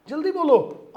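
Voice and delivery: loud, high-pitched call to answer; urgent prompt. Real, not acted. Teacher pushing students for the answer, urgent and loud. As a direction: call it out to hurry the class.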